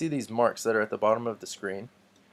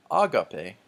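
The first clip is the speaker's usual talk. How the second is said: "agape" slowly with the first A stressed, A-ga-pe; wrong first-syllable stress; 5 dB louder than usual. Shown as a demonstration. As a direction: punch the opening vowel of "agape". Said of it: "tells me that this isn't".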